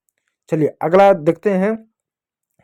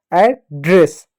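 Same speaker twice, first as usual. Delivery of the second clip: Address split as "ad", pause, "dress"; broken in parts; much slower than usual. Shown as a demonstration. Teacher showing children how to read it, in two parts. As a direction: sound out address in two separate pieces.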